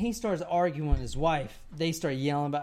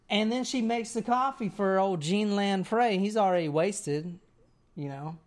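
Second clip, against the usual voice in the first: ordinary talk, nothing marked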